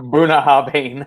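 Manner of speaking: Bunnahabhain sounded out slowly with a laugh, as BOO-nah-HAH-bin; slow, laughing try at Bunnahabhain; careful Scotch name. Shown as a demonstration. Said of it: "you gotta sound like a drunk Scot".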